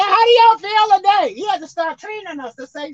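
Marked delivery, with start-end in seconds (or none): 0.0-1.3 louder, higher call to the church; preacher's rousing call; pastor calling out. Retold or imitated. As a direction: stir them up from the pulpit. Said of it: "He said".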